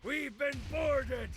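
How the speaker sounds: pirate captain. Acted. Pirate captain panicking on deck.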